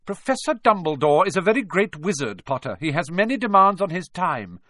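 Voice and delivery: high, firm reprimand; stern professor. A narrator's reading. McGonagall lectures Harry about Dumbledore's duties, stern. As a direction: put the pupil in their place.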